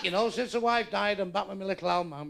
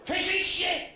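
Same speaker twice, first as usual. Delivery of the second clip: shouting at the deaf; 13 semitones higher than usual. Acted. Chip shop worker to a customer thought deaf, shouting. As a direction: yell it at a customer you think is deaf. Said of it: "They think you're fucking deaf".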